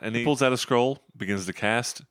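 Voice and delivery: usual speaking voice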